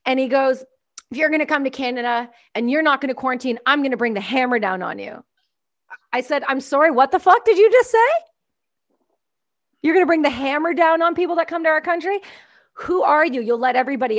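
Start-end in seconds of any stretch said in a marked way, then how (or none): none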